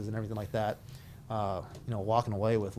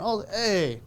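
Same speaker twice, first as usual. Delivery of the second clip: surprised greeting; 9 semitones higher, 5 dB louder than usual. Retold or imitated. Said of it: "retold phone greeting".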